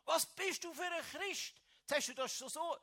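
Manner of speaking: hammed-up furious yell at the mother; retold angry attack. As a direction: lash out at the mother in a replayed fury. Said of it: "bin ich über die Mutter hergefahren".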